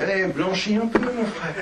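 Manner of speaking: high, laughing mock advice to a brother; a laughing, teasing jab, pitched high; mocking taunt. Mocking what others tell him.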